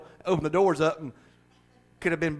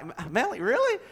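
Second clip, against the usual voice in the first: 1 mock disbelief; 8 semitones higher than usual. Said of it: "I'm like".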